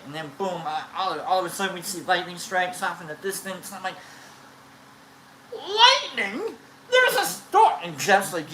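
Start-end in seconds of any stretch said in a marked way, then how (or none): 5.5-7.8 very high, loud shriek; mock alarm. Storyteller replays spotting lightning on the water, high mock-alarmed yell. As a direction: cry out in exaggerated alarm.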